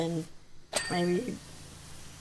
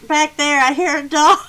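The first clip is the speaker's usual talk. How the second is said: louder, higher-pitched insistence; insistent; 6 semitones higher, 8 dB louder than usual. Retold or imitated. Guest pointing out the noise from the back, insistent. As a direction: imitate someone loudly insisting.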